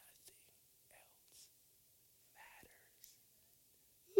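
God whispering to the troubled couple, a soft whisper. Acted.

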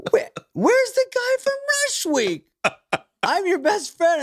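high, slow, whiny disbelief; a high, pleading voice that cannot believe it; shocked whiny pledge. Retold or imitated. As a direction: play the betrayed pledge, squeaking and drawn out.

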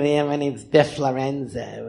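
low, slow curled-lip weirdo; Biff Lorenzo. Acted. Weirdo veteran introduces himself, low and slow. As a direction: drawl it low through a missing lip. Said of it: "I did this character".

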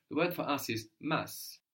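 usual speaking voice

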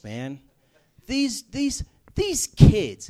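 put-on twenty-year-old friend ranting in the car, loud and high; heated rant. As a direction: vent hard about what bugs him.

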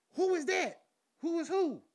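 high, sharp, baffled "who"; startled questions. Retold or imitated. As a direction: sound caught off guard at dawn. Startled bathroom exchange.

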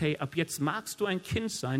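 normal talking voice

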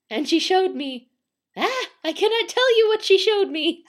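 high, loud, panicked outburst; terrified. A narrator's reading. Terrified man to soba seller, panicked. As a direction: build to a hammed-up wail of horror. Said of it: "gasped the terrified man".

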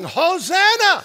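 loud high hosanna shout; crowd's cry. Retold or imitated. Crowd crying out to Jesus, loud joyful shout. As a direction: ring it out like the crowd at the gate. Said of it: "And they're crying".

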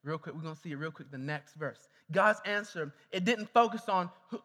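usual speaking voice

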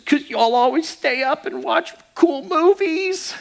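put-on high, slow child's complaint; lightly voiced daughter feeling left out; little girl voice. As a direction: whine it like a seven-year-old. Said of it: "And she says".

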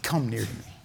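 very soft, low invitation; hushed, gentle call to come closer; tender forgiveness. Acted. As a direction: drop right down low and quiet. Forgiving Joseph instead of rant.

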